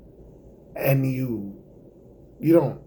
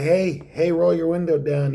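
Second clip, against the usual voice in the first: calling out; 6 semitones higher, 4 dB louder than usual. Acted. Stranger calling across at light.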